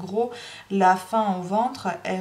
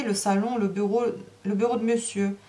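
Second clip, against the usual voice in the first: ordinary delivery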